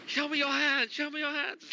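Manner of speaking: screaming cop, pitched high; police radio. Pretend. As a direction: bark the order like a scared officer. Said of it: "Okay, sorry".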